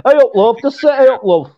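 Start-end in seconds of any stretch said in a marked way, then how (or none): 0.1-0.6 broad Yorkshire greeting. Shown as a demonstration. Co-host gives the ultimate local greeting, broad Yorkshire. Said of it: "you can't get any more Yorkshire than that". 0.9-1.5 thick Yorkshire "Hey up, love". Shown as a demonstration. Co-host tells friend what to say, broad Yorkshire. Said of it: "you can't get any more Yorkshire than that".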